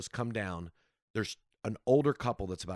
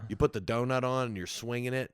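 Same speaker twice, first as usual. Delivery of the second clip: ordinary delivery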